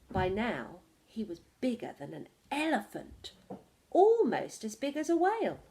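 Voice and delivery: usual speaking voice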